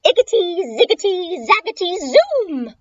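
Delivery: high, rhythmic incantation; witch casting spell. Acted. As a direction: chant the magic words in a witchy voice. Witch chants her magic spell.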